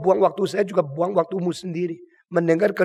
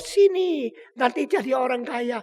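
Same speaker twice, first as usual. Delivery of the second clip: high-pitched, coaxing call; mock enticing; 6 semitones higher than usual. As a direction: beckon listeners in a put-on high voice. Acts preacher luring people with riches.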